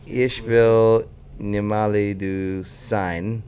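very slow, halting German; halting reading. Real, not acted. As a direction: sound out the German word by word. Struggling to read the German clue.

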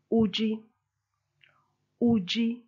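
Uji with a high start, falling off; Chiluba Uji for you are. Shown as a demonstration. Teacher models Uji, meaning you are. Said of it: "You are is".

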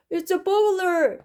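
high-pitched, slow exclamation; drawn-out character alarm; alarmed story father. Acted. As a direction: cry out as a startled storybook dad.

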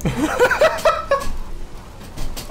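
high breathless laugh; cracking up at a near miss; squeaky airy giggle; burst of laughter. Real, not acted. Laughing at dropped pizza.

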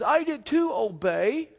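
put-on high, drawn-out childish retort; mock-indignant protest; Saul protesting. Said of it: "and Saul says".

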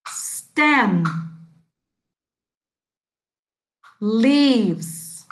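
each word said slowly and clearly, one at a time with long pauses; careful teacher modelling of "Soil", "Seeds", "Roots"; model pronunciation. Shown as a demonstration. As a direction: model every vocabulary word for repeating. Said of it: "Listen and repeat after me".

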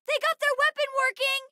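very high, urgent warning; high-pitched battle call-out; alarmed cry. Acted. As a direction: shout the bad news in a panic.

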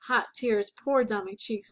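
plain reading voice

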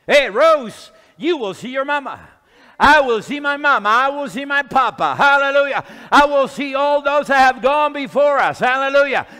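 loud, fired-up preacher in full flow; exultant preaching. Real, not acted. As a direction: cry out each promise to the congregation with mounting joy.

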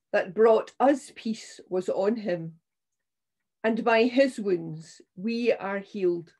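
ordinary talk, nothing marked

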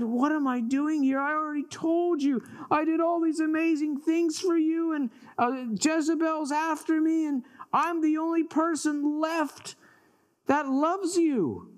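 high, aggrieved protest; exasperated complaint. Acted. Elijah answers God's whisper, high aggrieved complaint. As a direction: protest high, pleading to be heard.

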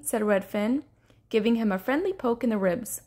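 ordinary delivery

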